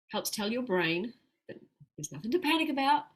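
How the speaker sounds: usual speaking voice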